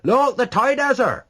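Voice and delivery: high, loud reply; shouted answer. A narrator's reading. A man answering across the crowded quay, shouting. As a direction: shout it back over the wind. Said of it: "came the answer".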